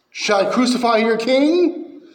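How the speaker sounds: a taunting question to the mob; Pilate questioning. Retold or imitated. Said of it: "Pilate's off".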